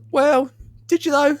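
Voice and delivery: high mock-sceptical jab; joking. Teasing Finn about his wolves, high mocking voice. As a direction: tease him with a sky-high doubtful lilt. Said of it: "And I joked".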